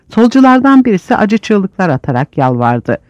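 ordinary delivery

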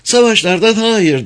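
high emphatic woman's voice; Mrs. Phelps. A narrator's reading. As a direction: echo it, pitched high. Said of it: "dedi Bayan Phelps".

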